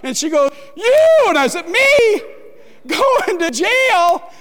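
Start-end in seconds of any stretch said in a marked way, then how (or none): 0.8-1.2 a very high, loud wail of one word; wailed accusation. Retold or imitated. Wife crying out that her husband is going to jail, high loud wail. 1.7-4.3 high and loud, then slow with a laugh; stunned disbelief. Retold or imitated. Preacher retelling his own shock at the jail news, laughing.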